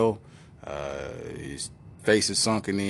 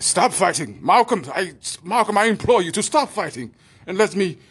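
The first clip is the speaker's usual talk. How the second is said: higher, louder pompous command; mock shout; 11 semitones higher, 6 dB louder than usual. Doctor wrestling Malcolm, loud higher command. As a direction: order him to stop struggling.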